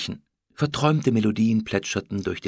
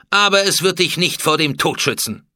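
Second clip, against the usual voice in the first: raised, imperious death threat; cold menace; 7 semitones higher, 8 dB louder than usual. A narrator's reading. Pharaoh threatens the food taster with death, cold menace. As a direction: pronounce his doom without pity. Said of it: "sagte Hatschepsut kalt".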